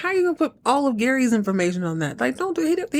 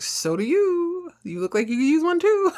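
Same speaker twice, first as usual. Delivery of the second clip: put-on smug drunk Earl; 10 semitones higher than usual. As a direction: toss it back, lightly voiced and smug. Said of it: "He's like".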